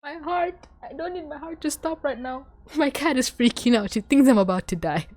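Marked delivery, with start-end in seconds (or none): 0.0-2.5 tiny, high, soft voice; overwhelmed squeak. Real, not acted. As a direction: squeeze it out small and high, nearly overcome. Overwhelmed fan pleading with heart.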